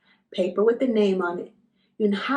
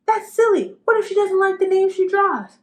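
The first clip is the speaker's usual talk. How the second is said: voiced boy objecting; 9 semitones higher, 4 dB louder than usual. Ralph objecting in a story.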